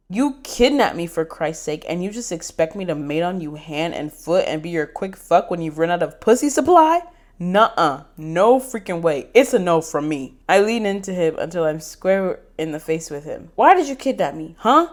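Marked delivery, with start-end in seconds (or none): 0.1-10.4 loud, heated telling-off; loud defiant refusal; angry rant. Acted. Angry confrontation, redone. 13.6-14.9 loud, angry demand; loud demanding snap; huffing demand. Acted. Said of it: "I huff".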